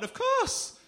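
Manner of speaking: very high, loud eager cry; eager yes. Retold or imitated. Comic lying to girlfriend, high loud eager cry. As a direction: fake eager promise. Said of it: "And I went".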